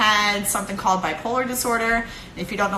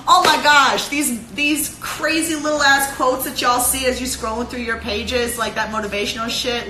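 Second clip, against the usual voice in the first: ordinary delivery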